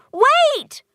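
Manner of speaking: very high, loud cry of wait; girl stopping the monster closing the door, urgent; urgent plea. Acted. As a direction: stop her before the door closes. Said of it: "Polly says".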